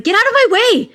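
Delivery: high-pitched exasperated mock yell. Woman showing how she talks to the dog underfoot, exasperated. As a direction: shoo it away in a high, impatient burst. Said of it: "Like that".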